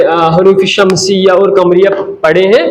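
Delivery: ordinary delivery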